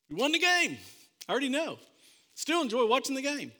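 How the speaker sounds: high, excited "We won the game"; gleeful husband. Retold or imitated. Husband explaining to his wife why he records games, gleefully high. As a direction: crow that you already know the result.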